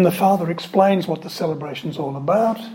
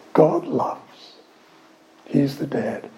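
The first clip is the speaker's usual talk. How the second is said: ordinary talk, nothing marked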